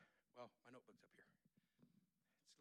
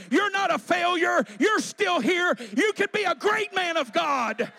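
impassioned exhortation, building higher and louder; rally the congregation to a shouted peak; full-force preaching; 6 semitones higher, 14 dB louder than usual. Acted.

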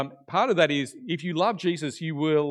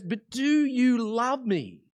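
slow and higher, drawn out; pleading question; 7 semitones higher, much slower than usual. Acted. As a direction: ask it slowly and earnestly, with a lifted voice. Jesus presses Peter again.

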